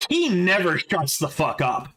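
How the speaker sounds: normal talking voice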